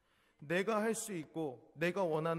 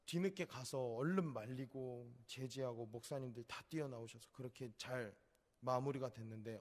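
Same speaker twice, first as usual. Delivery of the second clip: normal talking voice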